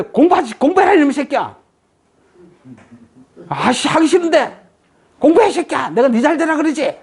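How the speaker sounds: mock angry mother nagging her son; mom scolding. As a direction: nag like an exasperated mom. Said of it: "이랬어요". (잔소리하는 엄마 흉내)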